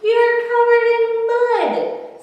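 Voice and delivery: squeaky, high, slow little dragon voice; high Dewdrop voice. A narrator's reading. Dewdrop scolds Sloppy for being muddy, in a high, squeaky voice. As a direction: lift way up into a tiny creature's voice.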